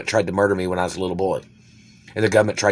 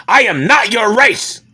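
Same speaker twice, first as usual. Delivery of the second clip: shouted much higher and louder, slow and hammered; furious shout; 13 semitones higher, 6 dB louder, slower than usual. Real, not acted. Shouting down the German man, slow furious yell. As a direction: yell it at them, word by word.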